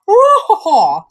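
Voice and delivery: slow hammed-up woo-ha-ha; mock evil laugh. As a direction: cackle it out slowly.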